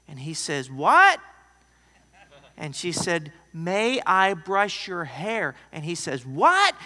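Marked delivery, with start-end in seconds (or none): 0.7-1.2 loud, high, blurted what; a deaf old man shouting back; startled shout of what; hard of hearing. Retold or imitated. Deaf old man can't hear. 3.5-5.5 louder ask. Retold or imitated. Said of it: "she had to yell it". 6.3-6.7 loud, high, blurted what; a deaf old man shouting back; startled shout of what; hard of hearing. Retold or imitated. Deaf old man can't hear.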